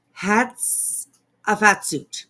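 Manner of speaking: "hats a fat suit" spelled out very slowly, stress on fat; slow, careful "hats a fat suit"; slow demonstration. Shown as a demonstration. Streamer shows how she says the surname, slowly. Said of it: "That's how I say it".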